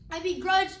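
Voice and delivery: acted burst of strong feeling; emphatic declaration. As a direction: push the words out forcefully. Grieving mother defiant about God.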